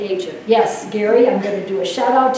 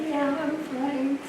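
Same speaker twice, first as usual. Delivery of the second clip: quiet reassurance; 4 semitones higher, 9 dB quieter, much slower than usual. Retold or imitated. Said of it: "And I said".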